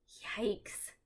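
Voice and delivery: breathy scared whisper; whispered yikes. Acted. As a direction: breathe it out nervously. Frightened whisper.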